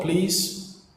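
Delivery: ordinary delivery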